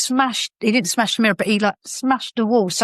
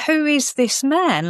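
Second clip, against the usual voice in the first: higher, slow baffled question; baffled disbelief; 7 semitones higher, slower than usual. Retold or imitated. Woman staring at her partner the morning after, slow bafflement. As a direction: puzzle over it slowly. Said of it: "and I'm like".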